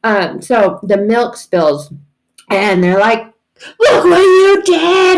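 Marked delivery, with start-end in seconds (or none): 3.8-5.2 slow, high, very loud wail; hammed-up family outburst; mock yelled accusation. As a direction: yell the blame, drawn out. Family erupts over spilt milk.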